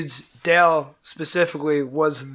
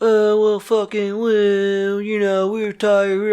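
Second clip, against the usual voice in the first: slow, higher mocking groggy whine; groggy bandmates; 4 semitones higher, much slower than usual. Hungover bandmates refusing the trip, slow mocking whine. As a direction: drag it out like you just woke up. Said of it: "And they're like".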